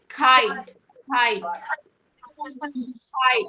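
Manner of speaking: खाई with a breathy kh; aspirated k. Shown as a demonstration. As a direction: let air follow the k in खाई. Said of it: "के के साथ एच का sound आएगा". (खाई में क के साथ एच)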